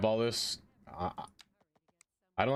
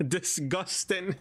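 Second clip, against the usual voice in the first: mock disgust; 8 semitones higher, much slower than usual.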